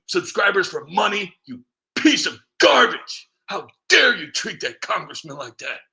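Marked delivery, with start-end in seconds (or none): 1.4-5.8 angry outburst. Real, not acted. Vlogger raging at congressman critics, loud angry insult. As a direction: spit out the insult.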